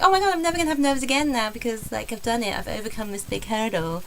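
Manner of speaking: put-on American accent, starting high then dropping. Comedian retelling her thought after the gig, American accent. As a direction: voice your own thought as an excited American. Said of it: "I didn't think it in an American accent".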